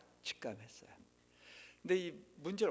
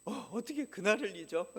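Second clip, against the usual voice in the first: son's indignant retort; 7 semitones higher, 7 dB louder than usual. Retold or imitated.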